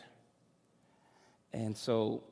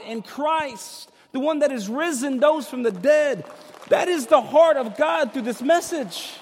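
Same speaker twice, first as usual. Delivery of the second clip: high, loud preacher's cry throughout; fiery preaching; 13 semitones higher, 10 dB louder than usual. Acted. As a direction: preach it at full force, voice lifted over the crowd. Preaching at full force.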